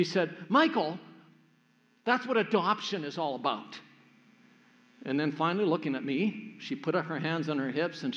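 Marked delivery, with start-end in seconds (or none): 0.5-3.8 a mock-grand announcing tone; a hint of a proud big sister concluding; grand flourish. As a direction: wrap it up with a lightly hammed-up flourish. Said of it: "with this grand flourish".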